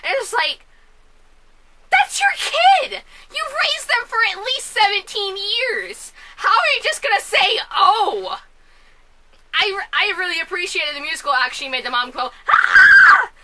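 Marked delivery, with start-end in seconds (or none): 1.9-8.4 high, loud, incredulous rebuke; scandalized, drawn-out high yell; outraged yelling. Acted. Outraged rebuke to the movie mom. 12.5-13.3 piercing, very high loud scream; sudden full-volume shriek; mother's scream. Retold or imitated. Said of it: "not trying not to scream that loud".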